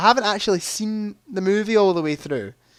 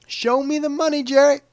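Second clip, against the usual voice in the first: high-voiced movie catchphrase, louder on the name; high-pitched film quote; 10 semitones higher, 3 dB louder than usual. Quiz player quoting the film after the right answer, high excited imitation. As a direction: pitch it way up and punch "Jerry". Acts out the film line again.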